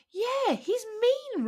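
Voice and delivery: very high, slow squeaky voice; agreeing in a sulky pout; squeaky bird agreeing; roadrunner voice. A narrator's reading.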